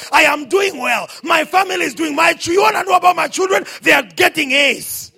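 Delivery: loud, driving pulpit declaration; full-force preaching. Real, not acted. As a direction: build to a shouted testimony.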